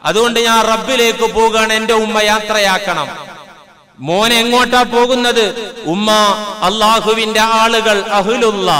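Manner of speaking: sustained, ringing preacher's delivery; impassioned sermon storytelling. A narrator's reading. Preacher retelling a saint's farewell to his mother, impassioned. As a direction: preach the tale in a drawn, level chant.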